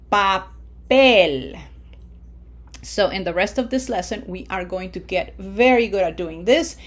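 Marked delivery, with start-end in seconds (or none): 0.1-1.6 Papel as pa-PEL, PEL loud and long; stress on last syllable. Shown as a demonstration. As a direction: punch the final PEL of Papel. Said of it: "put pronunciation emphasis in that syllable number one".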